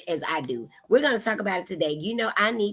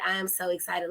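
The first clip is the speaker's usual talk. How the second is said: softer repeat; 2 semitones lower, 7 dB quieter, faster than usual. Real, not acted.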